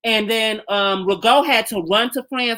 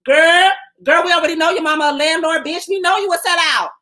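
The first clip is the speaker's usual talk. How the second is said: sassy callout; 7 semitones higher than usual. Pretend. Calling out a sellout.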